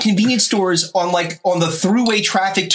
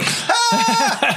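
high, sudden yelp of pain; imitated pained scream. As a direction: cry out as if hit. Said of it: "I'm like".

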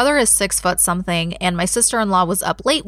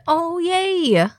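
squeal of joy; 10 semitones higher than usual. Real, not acted. Happy nephew now talks.

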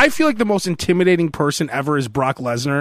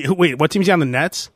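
normal talking voice

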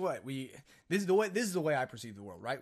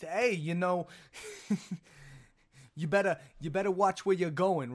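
louder, higher put-on Northeast accent; wildly off impression in a Northeast accent; 4 semitones higher, 4 dB louder than usual. Shown as a demonstration. Podcaster mocking his own impressions, exaggerated Northeast accent. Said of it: "sound like they're from the Northeast".

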